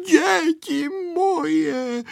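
high, long-drawn endearment; moved granny, very slow and high; tender grandmother. A narrator's reading. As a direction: stretch it out with loving emotion. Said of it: "řekla něžně babička".

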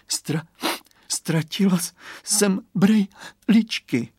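high, broken words between sobs; acted sobbing. A narrator's reading. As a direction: sob it out in fragments, like a child. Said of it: "zavzlikala Kateřinka".

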